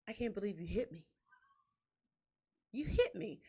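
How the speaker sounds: soft, wounded mock complaint; extra dramatic. Wife imitating husband's complaint all the way home, softly dramatic. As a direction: say it low, milking the hurt. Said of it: "it's gotta be extra dramatic".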